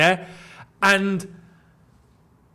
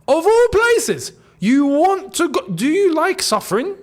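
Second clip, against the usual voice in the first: incredulous; 8 semitones higher, 3 dB louder than usual. Pretend. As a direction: ask it in utter disbelief. Incredulous question to player.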